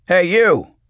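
loud, high-pitched call across the room; bellowing. A narrator's reading. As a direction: bellow to get someone's attention. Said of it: "he bellowed".

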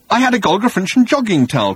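high, insistent prattle; fussy complaint. Acted. Arthur going on about his towel, fussy complaint.

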